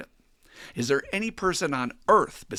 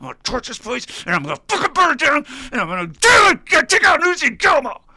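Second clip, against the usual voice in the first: building to a high, full-out yell; raging revenge rant; 13 semitones higher, 12 dB louder, faster than usual. Acted. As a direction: blow up into a deranged, screamed threat. Jim's resentful fantasy boils over.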